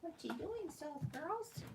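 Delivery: high, soft coo at the pets. Real, not acted. Owner cooing softly at her pets, high pet talk.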